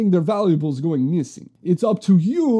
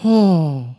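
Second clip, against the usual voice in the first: heavy loud sigh; 3 semitones lower, 5 dB louder than usual. Acted. Bee laments water.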